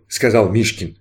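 ordinary delivery, nothing marked

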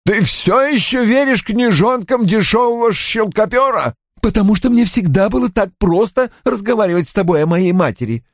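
high-pitched, biting sarcasm; daughter's heated jibe; sarcastic gibe. A narrator's reading. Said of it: "съязвила Анна".

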